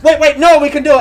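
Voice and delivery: loud, high, hurried backpedal; hammed-up salesman suddenly caving; mock panicked agent. Retelling the cable agent panicking at the cancellation, mock loud and high.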